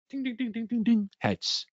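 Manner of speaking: high, soft, slow sing-song dings; mock signal dings. Explaining the coin toss drill to traders, soft high mock dings. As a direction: chime it out like a little bell, high and gentle.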